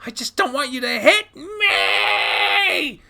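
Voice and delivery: high, tearful, drawn-out shriek; shaking shrieking scream. Acted. Child aiming rifle at dad, shaking shrieking scream. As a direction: yell until it turns into a scream. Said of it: "shrieking scream".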